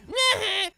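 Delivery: high burst of laughter. Real, not acted. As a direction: cackle at your own prank. Laughing after taunt.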